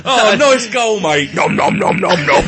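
loud, chomping mock greeting. Footballer greeting a teammate with bites, mock chomping. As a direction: cheer then chomp playfully. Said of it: "maybe it's a sign of greeting".

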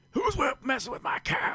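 put-on high-pitched angry old farmer; old guy voice. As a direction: holler like a cranky old guy. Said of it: "I can do the old guy voice".

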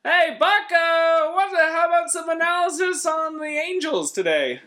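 hammed-up booth partner calling out; Pat Tabler. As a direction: holler it in a strained high comic voice. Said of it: "me and Pat Tabler calling a game".

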